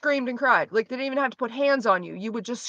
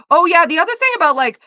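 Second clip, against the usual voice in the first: ordinary delivery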